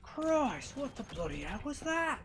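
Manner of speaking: high, slow shocked cry; jolted by a sudden ambush; startled outburst. Real, not acted. Gamer startled by an ambush, shocked high cry.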